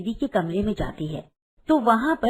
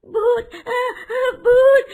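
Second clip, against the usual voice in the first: high, loud shriek with gasps; loud terrified scream; 13 semitones higher, 6 dB louder, much slower than usual. Acted. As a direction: scream out in fright at a ghost. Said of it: "सीमा जोर से चिल्लाती है".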